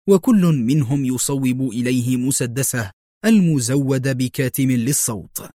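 ordinary delivery, nothing marked

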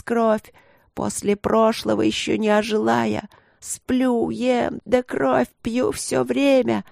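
high, drawn-out lamenting voice; old priestess, pleading. Acted. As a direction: plead and complain in a slow high whine.

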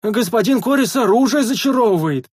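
high, carrying call; eager public announcement. A narrator's reading. Sentry telling another across the wall, eager shout. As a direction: holler the news eagerly. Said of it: "охотно поведал первый часовой во всеуслышание".

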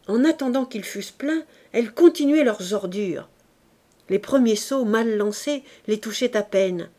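ordinary delivery

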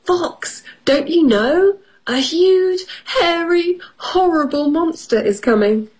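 high, urgent rabbit voice; hammed-up storybook rabbit alarm. A narrator's reading. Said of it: "Rabbit said".

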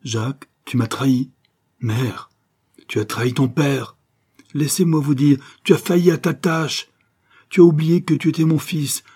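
accusing reproach. Acted. Mother tells her son he betrayed his father, accusing. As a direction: accuse him with rising force.

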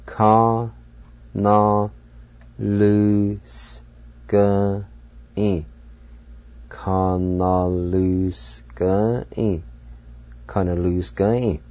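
Kana lose-gai drawn out slowly; slow Cherokee word. Shown as a demonstration. Teacher slowly models Kana lose-gai for learners. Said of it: "twice in Cherokee".

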